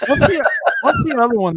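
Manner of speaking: talking through laughter; laughing. Real, not acted. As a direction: laugh out the question. Laughing while speaking.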